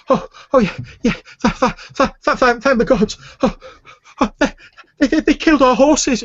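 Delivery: put-on high, loud, stammering old woman in distress; breathless elderly woman pleading for help; frantic old woman. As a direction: blurt it out through panic, stuttering.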